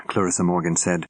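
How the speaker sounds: ordinary delivery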